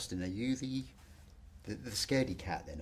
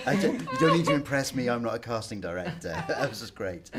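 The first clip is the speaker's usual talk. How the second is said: laughing; 4 semitones higher, 10 dB louder than usual. Real, not acted. Host laughing at kid's accent.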